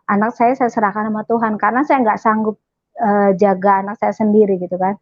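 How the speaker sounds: ordinary delivery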